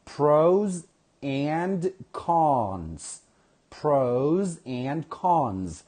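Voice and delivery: "Pros and cons" very slowly, each word drawn out; pronunciation model. Shown as a demonstration. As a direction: stretch out "Pros and cons" clearly for learners. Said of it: "listen to the pronunciation".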